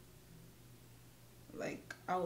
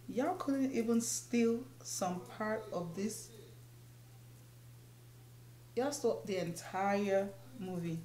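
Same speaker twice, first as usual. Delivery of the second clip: mock outrage; 3 semitones higher than usual. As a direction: retell the outrage, dragging it out. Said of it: "I was so pissed".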